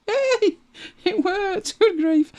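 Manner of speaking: delighted surprised yelp; thrilled it finally worked, cry out; happy yay. Real, not acted. Star shape finally works.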